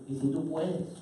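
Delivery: soft, doubtful plea; quietly voiced doubting request; hesitant father. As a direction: say it small and unsure. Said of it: "le dice así".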